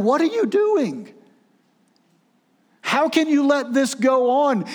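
pitched well up, pleading and bewildered; high pleading bewilderment; anguished question. Acted. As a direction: cry out to God in disbelief. Angels' anguished question voiced.

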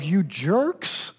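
short, fed-up "You jerks" with the pitch lifting at the end; exasperated "you jerks". Retold or imitated. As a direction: toss it off like a friend who's had enough. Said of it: "there would be a tone of".

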